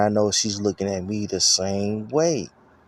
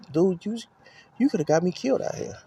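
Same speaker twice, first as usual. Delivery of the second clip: higher, faster exasperated comeback; incredulous imagined scolding, pitched up; indignant retort; 10 semitones higher than usual. Retold or imitated. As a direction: fire it back like you almost got killed. Imagined telling off the woman.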